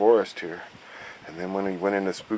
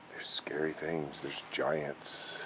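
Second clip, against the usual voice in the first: whisper; 5 semitones lower, 14 dB quieter, slower than usual. Acted. Spooky warning in chalk tour.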